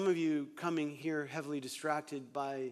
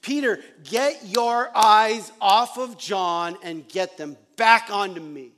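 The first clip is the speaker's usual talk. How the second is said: firm loud rebuke; 2 semitones higher, 12 dB louder, slower than usual. Retold or imitated. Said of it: "it's like Jesus is saying".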